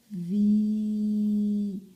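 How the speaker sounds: "vi" loud with a long, level i; stretched syllable. Shown as a demonstration. Teacher showing it is the same sound, "vi" held long. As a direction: sustain the vowel of "vi". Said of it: "é o mesmo som".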